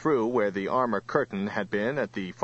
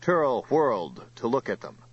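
ordinary delivery, nothing marked

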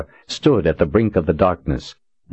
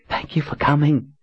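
tearful mumbling mother; 10 semitones higher than usual. Acted. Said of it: "she mumbled to Richard".

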